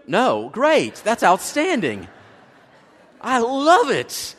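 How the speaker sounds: normal talking voice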